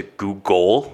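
"Google" said as "goo-GOL", slowly; early name pronunciation. Shown as a demonstration. Explaining the old name to friends, "Google" as goo-GOL. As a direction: stretch "Google" into goo-gol, second syllable full. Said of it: "like, G O O G O L".

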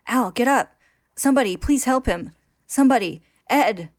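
loud, high cries for help; frantic. A narrator's reading. Narrator voices Winry begging help for unresponsive Al, frantic. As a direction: call out in rising desperation. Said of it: "becoming frantic as Al goes unresponsive".

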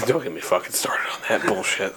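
hissed whisper; low angry whisper. Shown as a demonstration. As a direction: whisper it through clenched anger. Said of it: "repeated plainly".

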